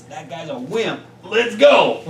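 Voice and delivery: high, loud little bug sneering; cartoon bug voice. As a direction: play the bug mocking a wimpy homeowner. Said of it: "bug's gonna go tell all his friends".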